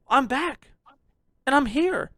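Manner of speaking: normal talking voice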